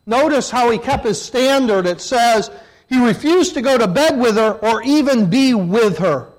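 loud, firm reading; emphatic preaching. Real, not acted. Preacher reading the verse aloud, loud and firm. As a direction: hammer the verse home. Preacher stressing Joseph's standard.

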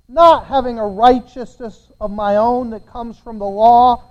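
normal talking voice

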